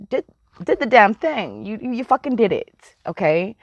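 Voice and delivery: ordinary talk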